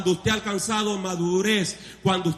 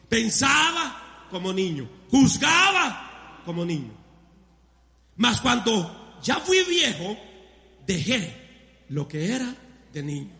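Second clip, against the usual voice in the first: slow scripture reading; slower than usual. Acted.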